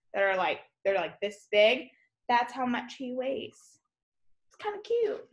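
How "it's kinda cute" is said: cooing cute voice. Real, not acted. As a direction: coo over it like a tiny pet, much higher and softer. Cooing over tiny shrew.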